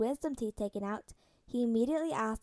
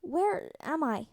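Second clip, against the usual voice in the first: slow, groggy question; drugged boy waking after surgery, slow and drowsy; drowsy confusion; 4 semitones higher, much slower than usual. Acted. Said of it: "Peter's eyes drooped".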